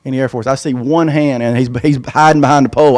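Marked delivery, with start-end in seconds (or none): none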